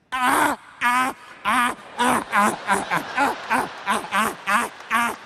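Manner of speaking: harsh rhythmic guttural ah ah ah laugh; Klingon laughter. Retold or imitated. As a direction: bark out an alien laugh. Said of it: "laughing like Klingons".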